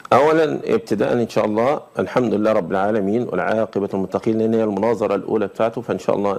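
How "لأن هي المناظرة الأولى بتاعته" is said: normal talking voice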